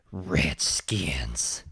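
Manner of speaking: low, whispered mock menace; dropped to a low, rough whisper; menacing cowboy. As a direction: imitate a cowboy hissing at his enemy. Cowboy spots his enemy, quoted.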